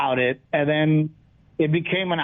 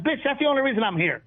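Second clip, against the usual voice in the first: high, fast, exasperated snap; mock exasperated retort; 9 semitones higher, faster than usual. Comedian firing back at J J Walker, high and fast. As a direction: fire it back high and quick, fed up. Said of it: "I'm like".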